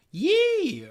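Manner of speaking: high, loud hillbilly "yee"; country yell. Shown as a demonstration. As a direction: whoop it like a country boy. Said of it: "add, like, a yell".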